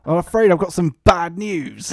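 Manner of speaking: hammed-up Bad News Barrett; Bad News Barrett impression. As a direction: demand the crowd's attention as a grim heel. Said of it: "So we've got Bad News Barrett".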